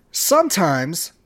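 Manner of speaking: normal talking voice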